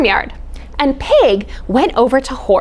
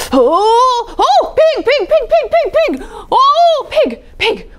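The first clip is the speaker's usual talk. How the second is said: Dog calling Pig, laughing; 12 semitones higher than usual. Acted. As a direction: shout for Pig excitedly.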